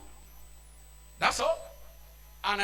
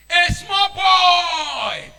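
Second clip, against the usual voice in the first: slow, loud, higher rebuke; preaching shout; 6 semitones higher, 9 dB louder, much slower than usual. Real, not acted. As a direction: thunder it out slowly.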